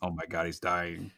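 touch his belly in alarm; panicked. Retold or imitated. Acted panicked reaction.

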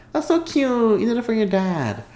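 high sweet gush over a cute gesture; high cooing gush. Retold or imitated. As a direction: coo it up high, delighted. Recalling her sweet thought.

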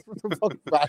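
usual speaking voice

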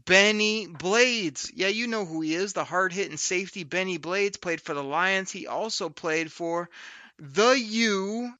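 upbeat host ad read; podcast promo read. Acted. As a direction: sell the show like an ad.

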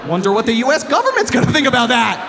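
mock threat. Husband to wife over undone laundry, mock threatening shout. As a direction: belt it out as a teasing threat.

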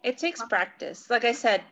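usual speaking voice

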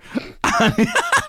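high squealing laugh; high burst of laughter. Real, not acted. Host cracking up at own Australian bit, squealing laugh. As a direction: laugh hard at your own joke.

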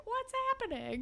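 very high, soft imitated voice; mock innocent bewilderment. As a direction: ask like a clueless sweet boy. Retold sweet, naive Connor.

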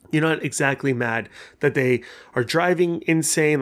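ordinary talk

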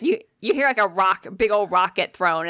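normal talking voice

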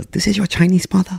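high mock accented mother voice; a hammed-up accented mum claiming her kid back; older brother playing a fake Chinese mother at night, high mock accent; imitating the Chinese mother. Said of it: "pretend that he was my Chinese mother".

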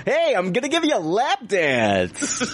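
high, laughing DJ-style stripper; radio voice stripper. Pretend. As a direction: hype up a lap dance offer. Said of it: "talk to you like that".

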